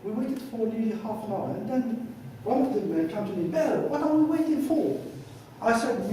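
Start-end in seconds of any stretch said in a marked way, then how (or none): 3.5-5.0 impatient questioning. Retold or imitated. Waiting man questions the translator.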